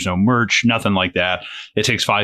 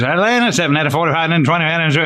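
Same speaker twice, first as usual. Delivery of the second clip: rapid mock auction call, pitched up; auctioneer; 7 semitones higher than usual. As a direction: rattle off numbers like an auctioneer. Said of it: "I feel like an auctioneer".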